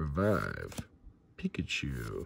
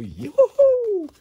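very high celebratory yoo-hoo; joyful whoop; 4 dB louder than usual. Real, not acted. Card opener celebrating birthday luck, high whooping. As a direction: let out a high, gleeful whoop.

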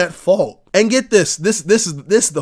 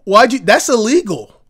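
loud, high, panicked insurance company voice; a hammed-up insurer yelling at a customer who crashed; mock alarmed insurer; 5 semitones higher, 10 dB louder than usual. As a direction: play the outraged insurer, shouting and stammering. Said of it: "They're like".

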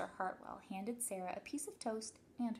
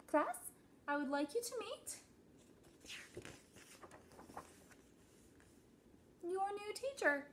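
high, formal introduction; principal's announcement; 11 semitones higher than usual. A narrator's reading. Principal introducing the new teacher, high announcing voice. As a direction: lift the voice and build to the reveal.

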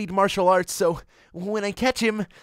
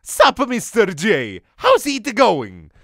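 louder, slow put-on thug voice; mock henchman; 7 dB louder, slower than usual. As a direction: greet the boss like a lazy goon. Henchman greets boss on phone.

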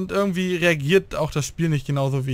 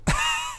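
high laugh; 3 dB quieter than usual. Real, not acted. Laughs after stepping on mine.

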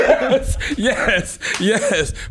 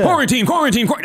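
hammed-up announcer call, much higher; mock announcer; 9 semitones higher than usual. Imitating [name] mocking an announcer, hammed-up announcer voice. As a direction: stretch it like a radio host hyping the show. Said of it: "mocking an announcer".

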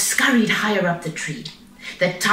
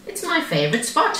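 high, breezy little cub; 11 semitones higher than usual. A narrator's reading. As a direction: answer high and carefree. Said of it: "he answered".

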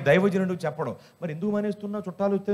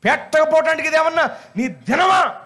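much higher, louder furious yell; shouting with burning anger; angry shout; 9 semitones higher, 5 dB louder than usual. Retold or imitated. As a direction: blast it out in exasperation. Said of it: "లోపల అనుకున్నాను".

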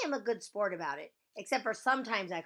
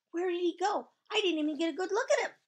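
eager urgency; 12 semitones higher, 8 dB louder, faster than usual. Acted. Eager to see handsome new boy.

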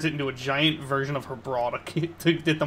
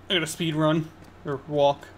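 usual speaking voice

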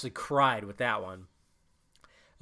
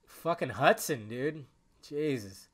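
usual speaking voice

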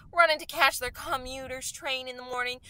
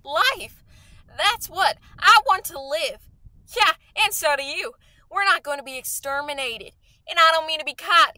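louder, higher, emphatic declaration; fierce outburst; 3 semitones higher, 5 dB louder than usual. Acted. Artilleryman insisting to a despairing stranger that he'll live, fierce outburst. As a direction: burst out with the will to live.

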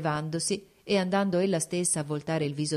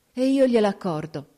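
said on a heavy sigh, louder; deep sigh; 4 semitones higher, 7 dB louder than usual. A narrator's reading. The princess granting the duchess leave, sighing deeply. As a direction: give in with a weary sigh. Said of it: "con un profondo sospiro".

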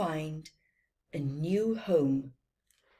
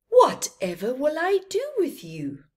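the merchant exclaims, raised and loud; a puzzled cry, pitched up; merchant, louder and higher; 9 semitones higher, 8 dB louder than usual. A narrator's reading. Merchant to the little camel, loud surprised exclaim. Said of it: "said the merchant".

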